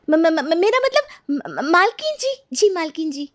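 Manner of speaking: high-pitched, panicky stammering servant; flustered stammer. Acted. Maid correcting a slip before her mistress, flustered and stammering. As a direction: stumble over the words, hastily correcting yourself.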